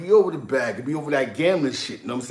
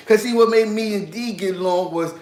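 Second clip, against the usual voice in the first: ordinary delivery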